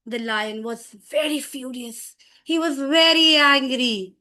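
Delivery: dramatic angry storytelling; showing anger. Shown as a demonstration. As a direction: narrate the lion's fury, building in force. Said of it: "when angry means you have to show anger".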